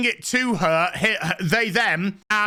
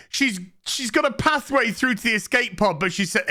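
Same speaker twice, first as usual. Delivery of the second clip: usual speaking voice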